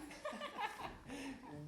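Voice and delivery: a short scornful chuckle; mocking laugh. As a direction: laugh at the old man's words. Courtiers mocking Gonzalo.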